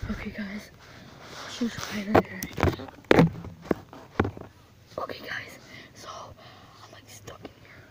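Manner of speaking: hushed whisper while hiding. Real, not acted. Hiding from the killer clown, whispering to viewers. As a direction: keep it to a breath so nobody hears.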